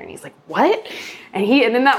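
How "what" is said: high, rising puzzled what; quick questioning yelp of curiosity, retold; intrigued surprise. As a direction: imitate a man suddenly caught off guard. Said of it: "caught his attention".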